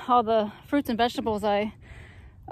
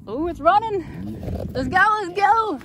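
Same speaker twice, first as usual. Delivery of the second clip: high, loud thrilled cheering; rising excited whoops; 8 semitones higher, 3 dB louder than usual. Real, not acted. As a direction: urge it on with real glee. Pump starts running.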